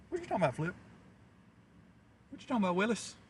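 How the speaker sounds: sitcom catchphrase. Pretend. Catchphrase gag, voice raised.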